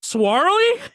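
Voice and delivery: high, loud disbelieving Swarley; surprised. Real, not acted. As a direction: squeal the name in disbelief. Surprised at name.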